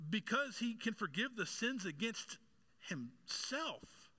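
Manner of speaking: high-pitched, emphatic sermon delivery; impassioned preaching. Real, not acted. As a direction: preach it with rising urgency, pitch swooping high.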